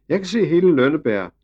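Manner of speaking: put-on high, loud little girl's call. A narrator's reading. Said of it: "råbte hun".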